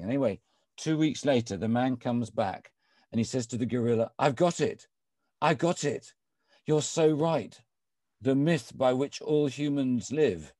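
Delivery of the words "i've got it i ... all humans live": high, louder breakthrough announcement; acted eureka excitement. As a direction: play a pupil who has finally cracked it. Man returns triumphant with his answer.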